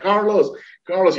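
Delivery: high-pitched call, not truly shouted; imitated calling out. Friend on the hill spotting him, calling out. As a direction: imitate hailing someone far off.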